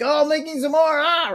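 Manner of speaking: hammed-up high whine; mock distress. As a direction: groan it like you're overflowing. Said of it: "And you're like".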